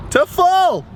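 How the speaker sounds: squeaky high stress on full; high squeak. Retold or imitated. Retelling the prank, stressed repeat.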